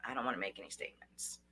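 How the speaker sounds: low, hushed, unruffled answer; calm refusal. Shown as a demonstration. Coach answering a pretend officer, calm refusal. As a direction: softly decline to answer. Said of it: "And talk slow".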